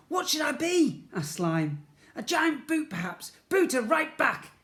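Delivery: high, loud excited plotting; character voices. A narrator's reading. Slime and Ned plotting, high and loud. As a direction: bounce the lines eagerly.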